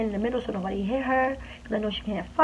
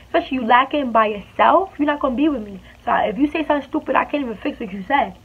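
usual speaking voice

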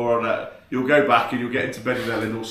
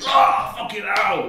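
higher, slow yelp of pain, half acted; replaying the sting with a higher, drawn-out mock yell; retold pained yell; 8 semitones higher, 4 dB louder, slower than usual. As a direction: cry out as if the wasp just stung. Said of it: "And I'm sat there going".

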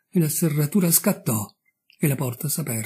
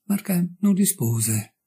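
plain reading voice

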